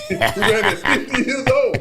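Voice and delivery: high, loud, laughing exclamation; laughing disbelief. Retold or imitated. Retelling a runner's feat to his friend, laughing high and loud.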